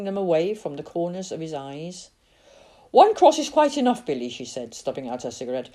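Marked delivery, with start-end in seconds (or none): 2.9-4.0 louder, higher firm aunt; firm Auntie May. A narrator's reading. Aunt warning Billy off the graveyard, firm. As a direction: put your foot down like a stern aunt.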